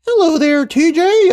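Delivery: very high put-on cartoon greeting; Ice King. Cartoon king greeting his friend, high silly voice. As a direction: improvise a squeaky figure voice. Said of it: "don't even know what the fuck this guy sounds like".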